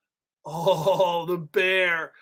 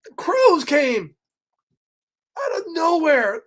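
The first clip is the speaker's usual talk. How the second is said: dramatic alarm; 8 semitones higher, 4 dB louder than usual. A narrator's reading. Reader dramatizing the crows arriving for children. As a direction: announce the danger to the kids. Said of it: "dramatic storytelling".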